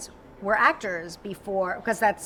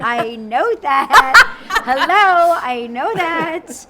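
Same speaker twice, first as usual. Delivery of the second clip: loud, slow, laughing protest; laughing retort; 4 semitones higher, 11 dB louder, much slower than usual. Retold or imitated. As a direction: laugh it out, mock offended.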